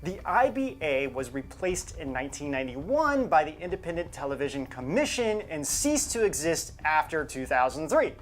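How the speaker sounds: ordinary talk, nothing marked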